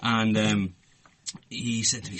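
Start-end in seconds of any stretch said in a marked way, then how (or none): none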